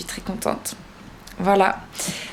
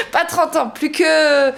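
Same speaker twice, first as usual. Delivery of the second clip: laughing; 7 semitones higher, slower than usual. Real, not acted. Laughs at thirty years of repayments.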